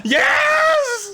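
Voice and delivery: very high cry, drawn out slowly; ecstatic cry. Real, not acted. Fan at the peak of the fight, ecstatic cry. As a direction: shriek in ecstatic disbelief.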